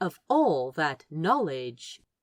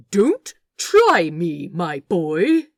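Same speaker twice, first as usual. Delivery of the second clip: slow, loud, menacing warning; dark; 8 dB louder, slower than usual. Acted. The angel warns the orangutan darkly, slow and loud. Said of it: "Aziraphale said darkly".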